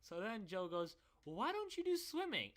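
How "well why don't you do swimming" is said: very high, louder mock suggestion; exaggerated high-pitched imitation; high-voiced friend. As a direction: pipe it up like an eager pal. Acts out friend Joe's suggestion.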